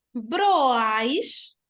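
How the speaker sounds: "Broais" com O e A unidos, esticado; sílabas juntas. Shown as a demonstration. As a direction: junte as vogais em "Broais", bem marcado. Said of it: "ficam juntos também". ("Broais" emendado e subindo no fim)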